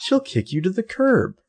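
mock condescending voice, pitched well up; put-on patronizing, high-pitched warning. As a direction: imitate someone talking down from on high. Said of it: "like, condescending".

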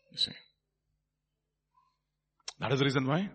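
ordinary talk

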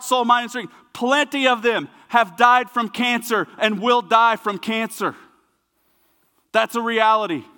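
high, forceful, emphatic sermon voice; impassioned preaching. Real, not acted. Preacher defending suffering believers, impassioned and forceful. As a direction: drive each point home with conviction.

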